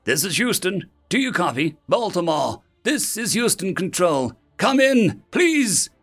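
high, urgent pleas to each city; anxious mission control hailing; desperate radio calls. Acted. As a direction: keep hailing anxiously, pleading for a reply. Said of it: "Panicked voices washed over one another".

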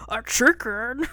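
loud, slow, hammed-up disbelief; booming voice, shocked. Booming voice hearing of the chicken, hammed-up loud. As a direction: boom it out high in mock outrage. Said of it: "the booming voice goes".

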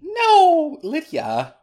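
sudden shocked high "No"; surprised gasp. Real, not acted. Realizes they skipped the intros.

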